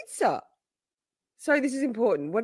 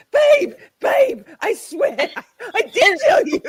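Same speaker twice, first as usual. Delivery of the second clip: higher, louder, laughing through the words; laughing insistence; 10 semitones higher, 7 dB louder than usual. Retold or imitated. Steph laughing, insisting to Lisa she told her. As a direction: swear you told her while cracking up. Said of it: "She's like".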